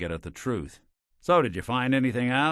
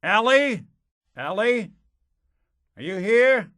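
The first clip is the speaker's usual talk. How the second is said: high, raised searching call; 13 semitones higher, 6 dB louder than usual. Acted. Detective looking for Ellie the secretary, calling out. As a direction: call out questioningly for someone out of sight.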